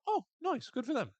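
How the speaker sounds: very high, airy mock approval; lightly voiced delighted onlooker; impressed Travis. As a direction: chirp it like a pleased dude. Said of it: "Travis ... being like".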